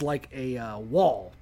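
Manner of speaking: ordinary talk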